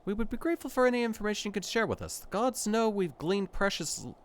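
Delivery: polite companion. Acted. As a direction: ask it briskly and properly. Voices polite companion NPC.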